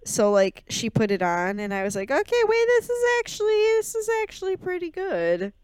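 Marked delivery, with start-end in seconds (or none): 2.1-5.5 very high-pitched retold realization; pleasant surprise. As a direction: go way up high, acting out being won over. Retold dawning approval of the film.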